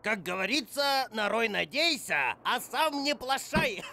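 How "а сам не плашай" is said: laughing through the saying. Real, not acted. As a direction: chuckle the proverb out. (смеясь)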